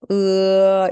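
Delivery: sustained "으" easing open into 어; gliding vowel. Shown as a demonstration. Teacher repeating the model vowel, "으" slowly opening to 어. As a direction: stretch "으", ending on 어. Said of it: "입을 으에서 어로 천천히 벌리면서".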